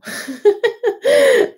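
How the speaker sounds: high, embarrassed burst of laughter. Real, not acted. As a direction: laugh it off, caught out. Laughs at own uncertainty.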